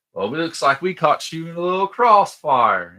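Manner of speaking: loud, high-pitched mocking taunt; hammed-up gloating cowboy holler; Curly Bill. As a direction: whoop it out like a gloating outlaw. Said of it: "Curly Bill's".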